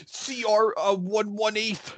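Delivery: high, slow, halting imitation; actor fumbling lines. Friend imitating an actor fed game rules, mock fumbling. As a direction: stall and stretch it like a lost performer. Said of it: "And Eric's just like".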